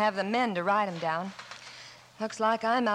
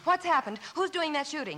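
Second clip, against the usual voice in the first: alarmed; 6 semitones higher than usual. Acted. Alarmed at gunfire and yelling.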